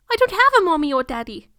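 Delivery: put-on high, loud childish voice, sad; sad little boy. Orphan boy answering the stranger at the door, high sad child voice. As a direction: play the lonely orphan boy. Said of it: "Harry replied sadly".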